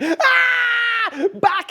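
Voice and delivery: high, loud panicked yell "Ah! Back!"; terrified scream. Acted. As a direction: shriek in sudden fright at the dark. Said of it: "he screamed".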